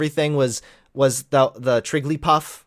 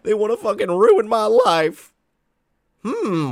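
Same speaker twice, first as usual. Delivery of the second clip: higher, hammed-up echo of the man from the clip; mock whiny victim; 8 semitones higher, 3 dB louder than usual. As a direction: parrot him in a mocking whine.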